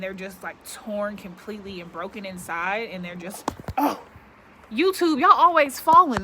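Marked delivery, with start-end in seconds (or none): 4.7-6.2 1 exasperated yelp. Real, not acted. Wind knocks over the camera.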